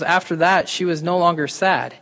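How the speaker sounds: normal talking voice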